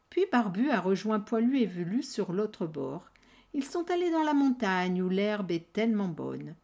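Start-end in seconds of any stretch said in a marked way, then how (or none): none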